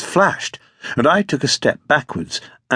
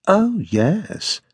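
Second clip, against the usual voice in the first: mildly harsh, higher-pitched retort; a bit harsh; 5 semitones higher than usual. A narrator's reading. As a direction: answer with a touch of harsh sarcasm. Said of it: "in a voice that sounded a bit harsh".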